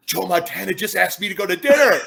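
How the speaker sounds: mock starstruck squeal of a fan; giddy schoolgirl. Retelling meeting his idol, giddy like a schoolgirl. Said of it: "I was like a schoolgirl".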